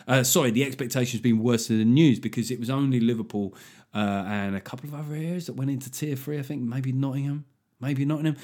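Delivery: ordinary talk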